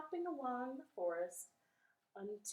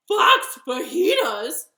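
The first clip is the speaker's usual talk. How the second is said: loud, high shocked echo; horrified fox; 8 semitones higher, 19 dB louder, slower than usual. Acted. Fox learns he's on the menu, horrified cry. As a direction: repeat it in loud, hammed-up horror.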